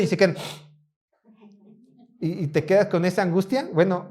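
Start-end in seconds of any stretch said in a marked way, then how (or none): none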